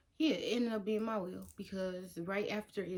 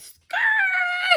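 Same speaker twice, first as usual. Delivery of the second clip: high, loud vocal screech; piercing imitation of a skidding car; imitated car screech; 13 dB louder than usual. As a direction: screech like scraping metal. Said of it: "So it's like".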